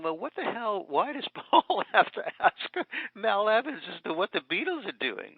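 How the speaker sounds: high, loud, laughing disbelief; incredulous. Retold or imitated. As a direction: ask it in amused disbelief. His own disbelieving thought, laughing.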